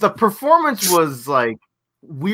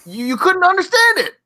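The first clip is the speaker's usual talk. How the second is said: exasperated high complaint; 14 semitones higher, 4 dB louder than usual. Real, not acted. Podcaster complaining about garbled lyrics, high-pitched exasperation.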